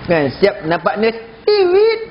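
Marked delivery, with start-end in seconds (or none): 1.5-2.1 high two-note whistle sound; squeaky "tee-wit" whistle; mock wolf whistle. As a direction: imitate a cheeky newborn's catcall. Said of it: "dia kata macam tu".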